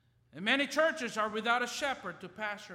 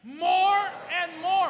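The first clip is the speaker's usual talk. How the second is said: higher, louder, slow and drawn out; with vigor and a smile; 8 semitones higher, 11 dB louder, slower than usual. Shown as a demonstration. Pastor urging the church to say it with a smile, joyful and loud. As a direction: lift it up joyfully. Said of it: "put a smile in our face".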